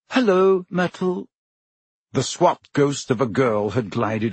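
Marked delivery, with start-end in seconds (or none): 0.1-1.3 high, falsely friendly hello; flustered, pitched-up hello; awkward greeting. A narrator's reading. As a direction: greet as if just caught out. Hermione caught out, greets Myrtle.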